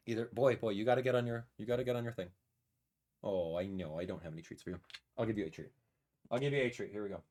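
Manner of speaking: low, soft aside; gentle pet talk. Real, not acted. Streamer offering his dog a treat, gentle. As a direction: drop the voice and talk softly to a dog.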